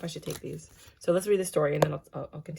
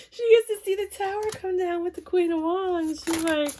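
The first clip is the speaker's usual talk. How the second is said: high and louder, still laughing; real laughter breaking through the words; 13 semitones higher, 4 dB louder than usual. Real, not acted. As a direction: push the line out through giggles. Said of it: "guys, I can't".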